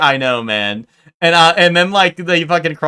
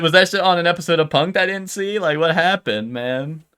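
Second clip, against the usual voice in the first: ordinary talk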